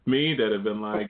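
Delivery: ordinary talk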